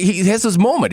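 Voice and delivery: normal talking voice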